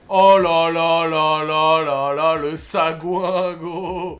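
slow, loud stretched oh là là; drawn-out outrage. Real, not acted. Gamer killed by a lucky shot, slow outraged wail. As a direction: drag it out in mock despair.

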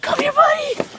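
high delighted shout of the name; excited call. Real, not acted. Calling the turtle Billy, high excited squeal.

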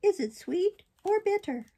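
high, slow puppet whine; plaintive. A narrator's reading. Puppet asks the fairy about medicine, plaintive. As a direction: plead in a high, plaintive little voice. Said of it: "asked in a plaintive voice".

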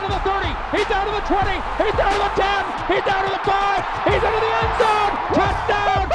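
excited. Real, not acted. Hearst run reaching the end zone.

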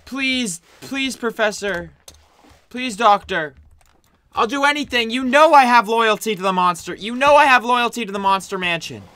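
high pleading voice; mild begging. Acted. Assistant begs the doctor for mercy, high pleading. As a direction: beg for your life.

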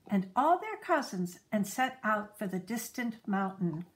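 usual speaking voice

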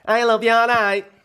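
high, loud northern character; Wendy North. Acted. Wendy declares love, high loud impersonation. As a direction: belt it out in a high put-on voice. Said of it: "Wendy North".

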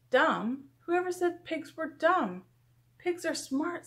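high, protesting comeback; indignant retort. A narrator's reading. Megan defending the pigs to the teacher, high indignant retort.

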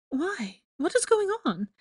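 high, worried Why and rising alarm; she's frightened and demanding answers; alarmed question. Acted. Hermione alarmed by Malfoy.

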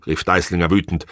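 ordinary delivery, nothing marked

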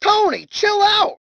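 high-pitched, drawn-out plea; alarmed protest. Acted. Passenger begs Tony to chill out, alarmed. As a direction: cry out for him to ease off. Protests at Tony's wild behaviour.

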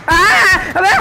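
short, startled outburst; startled yelp. Real, not acted. Player suddenly hit in the game, startled yelp. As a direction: yelp as if caught off guard.

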